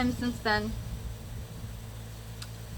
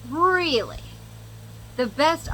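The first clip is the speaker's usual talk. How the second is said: incredulous; 6 semitones higher, slower than usual. Retold or imitated. Mock indignant retort to ex.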